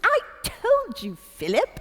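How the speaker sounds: smug little royal I told you so; the Queen. Retold or imitated. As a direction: tease your husband gently in a high voice. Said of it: "She goes".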